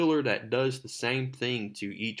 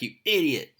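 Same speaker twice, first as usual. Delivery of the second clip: mock angry outburst; 4 semitones higher, 4 dB louder than usual. Phone call ends in insult.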